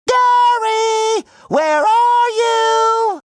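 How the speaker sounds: high, drawn-out searching call. Acted. Calling for missing Gary, high drawn-out call. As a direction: call far off for a lost pet.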